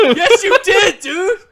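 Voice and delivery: high, loud laughing comeback; laughing insistence. Real, not acted. Host insisting guest said "a boot", laughing loudly. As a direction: shout it back through real laughter.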